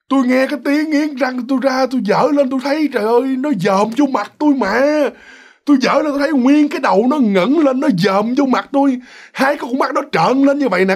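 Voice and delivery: high, breathless-with-alarm wife retelling a fright; horrified outburst. Retold or imitated. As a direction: insist in panic on what you saw. Vợ kinh hãi kể lại. (giọng vợ hoảng sợ, la lối)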